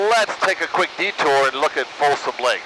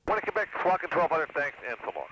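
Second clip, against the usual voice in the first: pilot radio call, quieter; over the radio; 3 semitones higher, 10 dB quieter than usual. Real, not acted. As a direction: speak into the aircraft radio.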